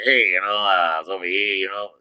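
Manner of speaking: lightly imitated Cleveland accent; a loose impression of a Cleveland athlete being interviewed; Bernie Kosar. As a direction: mumble it in a mock Cleveland accent. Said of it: "Bernie Kosar in interviews".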